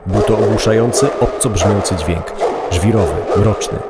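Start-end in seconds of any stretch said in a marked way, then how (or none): none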